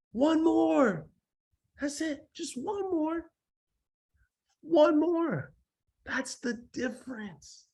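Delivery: very high, strained little voice; high-pitched amazement. Real, not acted. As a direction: squeak it out high and drawn out.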